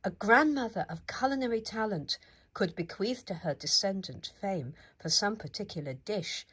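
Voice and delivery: ordinary delivery, nothing marked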